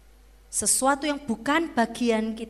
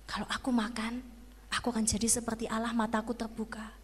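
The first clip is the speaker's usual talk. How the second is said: soft, breathless secret whisper; whispered thought; 5 semitones lower, 9 dB quieter, faster than usual. Acted. Eve whispers her forbidden wish to herself, hushed. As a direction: whisper it to yourself, tempted.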